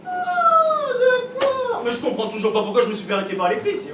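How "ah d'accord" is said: surprised. Retold or imitated. Grandfather's sudden understanding.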